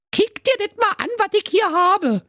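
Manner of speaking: very high, pinched Berlin housewife; Berlin wife voice. Acted. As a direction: squeak it out like a cheeky Berliner Göre. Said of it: "da sprach seine Frau". (hohe, schrille Berliner Ehefrau)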